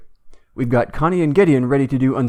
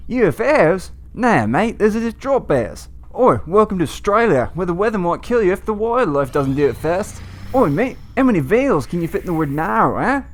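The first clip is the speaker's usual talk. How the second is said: hammed-up Australian accent, higher; bad Australian accent; 6 semitones higher than usual. Welcoming to Australia in a hammed-up Australian accent. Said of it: "That accent was appalling".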